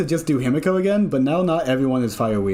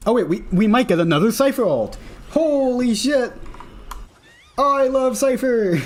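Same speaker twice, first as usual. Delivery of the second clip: excited; 9 semitones higher than usual. Real, not acted. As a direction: gush with delight. Big moment in game, cheering.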